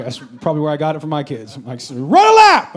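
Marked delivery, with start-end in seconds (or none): 1.9-2.7 sudden high, very loud bark; explosive imitated dad shout; mock drill-coach yell. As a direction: bellow it as an order. Mock yelling at his kids.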